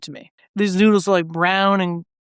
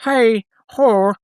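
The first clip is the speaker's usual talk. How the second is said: Irish accent; 3 semitones higher, 3 dB louder than usual. Shown as a demonstration. Host tries on an Irish accent asking for Jameson, hammed-up. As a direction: play a stage Irishman calling for whiskey, lightly done. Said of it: "trying to get into my Irish accent".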